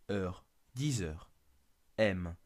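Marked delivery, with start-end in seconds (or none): none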